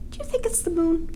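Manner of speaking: plain reading voice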